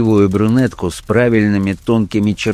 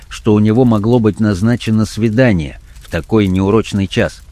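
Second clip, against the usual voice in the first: ordinary delivery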